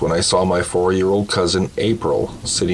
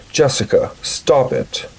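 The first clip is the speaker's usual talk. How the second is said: high, sharp mock yell at Jessica; older sister yelling at the imaginary friend; retold yell; 6 semitones higher than usual. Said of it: "and yelled".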